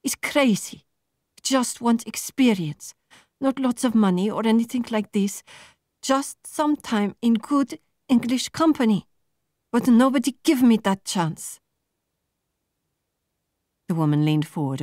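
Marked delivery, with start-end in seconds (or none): 0.0-11.6 higher, frustrated demand; plain angry. A narrator's reading. Marta confronting the recruiters, openly angry. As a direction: push back hard at the interviewer. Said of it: "plain angry now".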